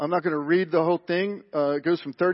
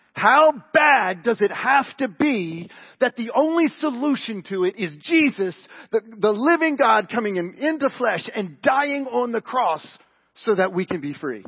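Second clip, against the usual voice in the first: high, loud, insistent preaching; impassioned preaching; 7 semitones higher, 9 dB louder than usual. Acted. Preacher confronting the congregation with the cross, impassioned preaching. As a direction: drive each phrase home forcefully.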